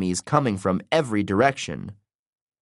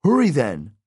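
pressing, raised-pitch prompt; urging; 4 semitones higher than usual. A narrator's reading. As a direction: hurry them along. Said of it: "he urged".

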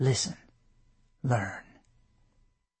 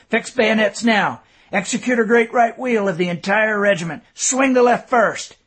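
louder, higher command; firm command; 6 semitones higher, 6 dB louder than usual. A narrator's reading. Colonel commands his men to fix bayonets, firm and loud. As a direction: bark the order to the men.

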